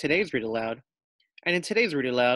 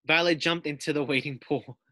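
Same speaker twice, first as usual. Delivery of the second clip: usual speaking voice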